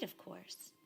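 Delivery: usual speaking voice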